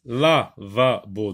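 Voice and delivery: "Lavabo" slowly split into la, va, bo; "Lavabo" spaced out for spelling; syllable by syllable. Shown as a demonstration. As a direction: give each syllable of "Lavabo" its own beat. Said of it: "vous écrivez les trois syllabes".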